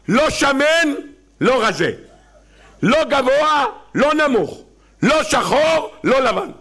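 high, rising list ending in a shout; comic demands. Acted. (רשימת דרישות בקול גבוה ונרגש)

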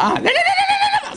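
put-on very high old woman protesting; hammed-up high granny voice; old grandmother. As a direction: squeal out the refusals like a sweet old grandma. Grandmother refusing, acted in story.

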